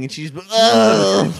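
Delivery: slow, high exasperated ugh; annoyed groan. Retold or imitated. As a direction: groan like a grumpy coworker. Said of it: "you could ask her".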